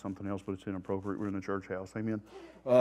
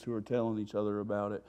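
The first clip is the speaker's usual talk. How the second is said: ordinary talk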